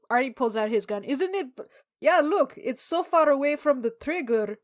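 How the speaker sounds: ordinary delivery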